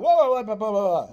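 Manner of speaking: taken aback, calling out to calm him; startled whoa. Real, not acted. Startled by brother's yelling.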